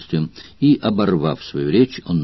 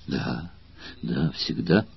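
trembling with emotion; 3 semitones lower, 11 dB quieter, slower than usual. Acted. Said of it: "произнёс он дрожащим от волнения голосом".

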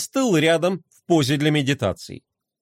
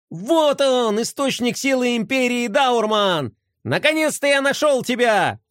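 heroic toy voice; 7 semitones higher than usual. A narrator's reading. Boy playing heroic toy.

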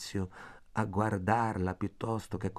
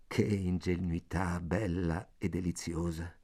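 ordinary delivery, nothing marked

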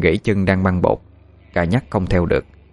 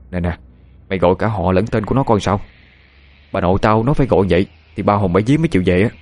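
plain reading voice

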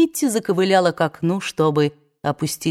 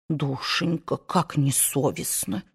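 put-on soft, slow, hurt old aunt; a touch of wounded whimpering; tearful aunt; 3 semitones lower, 8 dB quieter than usual. A narrator's reading. Aunt hurt by Scarlett's words, softly tearful. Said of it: "всхлипнула тётушка Питтипэт".

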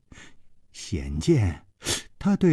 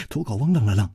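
ordinary delivery, nothing marked

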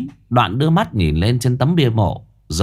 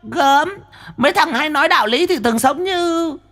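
much higher, teasing woman; mocking woman. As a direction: needle the hypocrite. Medium mocking Quý.

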